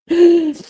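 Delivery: loud, high intake of breath; big gasp. Real, not acted. Streamer recovering from chat's jokes, gasping loudly. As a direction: gasp hard for air after laughing. Catching breath after laughing.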